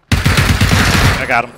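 drawn-out loud triumphant call; excited. Real, not acted. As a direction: stretch it out, proud of the kill. Kill claimed in firefight.

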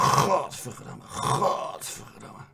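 slow whispered cursing; hissed curse. Retold or imitated. Princess cursing at the mirror, slow whisper. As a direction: hiss it, seething. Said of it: "zei ze".

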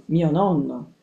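plain reading voice

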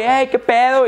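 high, friendly mock greeting; mock buddy greeting. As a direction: play the hangers-on cheering you. Friends greeting when successful. (saludo de cuate burlón, agudo)

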